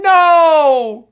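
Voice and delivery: loud, high-pitched cry of real dismay; dismayed shout. Real, not acted. Player protesting a failed move in game, dismayed shout.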